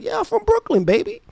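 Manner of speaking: high-pitched cocky boast, re-enacted; playful swagger. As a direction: toss it off as an instinctive brag. Repeats cocky catchphrase answer.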